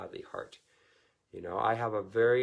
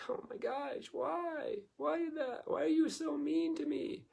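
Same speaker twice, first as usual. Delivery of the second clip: very high, whiny wounded voice; mock wail of a hurt child; hurt whine. Hurt child asking a mean parent why, high and whiny. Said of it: "And you go like".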